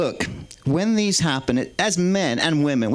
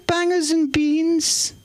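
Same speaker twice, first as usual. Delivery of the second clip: very high, slow posh English Queen impression; the Queen's voice; 11 semitones higher, much slower than usual. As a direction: play the Queen reading a menu, high and drawn out. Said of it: "the Queen's voice".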